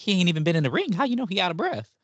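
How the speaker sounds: indignant, high-pitched challenge; incredulous retort. Retold or imitated. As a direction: push back in disbelief, pitch climbing. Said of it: "and I'm like".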